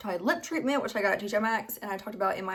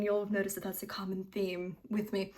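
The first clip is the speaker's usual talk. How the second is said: soft unvoiced aside; whispering; 6 dB quieter than usual. Real, not acted. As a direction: whisper it like a confession. Whispered self-deprecating aside.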